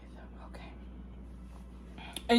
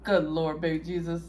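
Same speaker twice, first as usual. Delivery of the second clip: ordinary delivery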